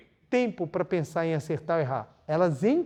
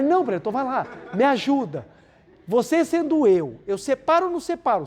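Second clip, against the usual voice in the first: higher, louder, laughing plea for help; mock pleading believer, pitched up; pleading congregant; 6 semitones higher, 4 dB louder than usual. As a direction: beg the preacher half-laughing. Congregant begging for advice.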